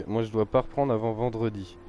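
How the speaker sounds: ordinary talk, nothing marked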